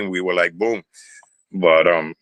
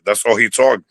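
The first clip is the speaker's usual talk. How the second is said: ordinary talk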